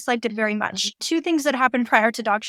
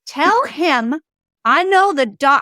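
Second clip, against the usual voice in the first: mildly louder, a touch higher, a put-on huff; mock angry ghost; 4 semitones higher, 6 dB louder, slower than usual. Medium retelling a pissed-off ghost's demand, mild mock anger. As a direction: channel the spirit's annoyance, only lightly. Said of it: "she gets pissed and she says".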